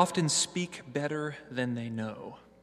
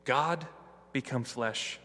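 ordinary talk, nothing marked

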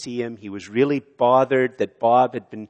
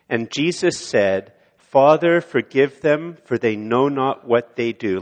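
recited with congregation; 2 semitones higher, 6 dB louder than usual. Shown as a demonstration. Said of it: "Could you say this with me?".

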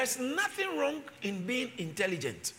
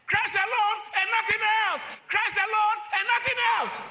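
higher, louder, hammering each line home; preaching at full force; 10 semitones higher, 3 dB louder than usual. Acted. Pastor urging the congregation to just preach Christ, full-force preaching. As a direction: preach it like a fiery revival.

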